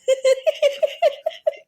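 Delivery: high-pitched burst of laughter; high, helpless laugh; real laughter. Real, not acted. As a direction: crack up in a high giggle. Laughing at the bed joke.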